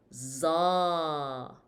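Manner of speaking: "za" with a long, drawn-out a; thick "za", vowel held. Shown as a demonstration. Teacher adds a to the letter, long za. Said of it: "we add a to the pronunciation".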